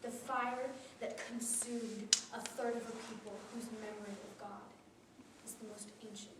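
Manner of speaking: usual speaking voice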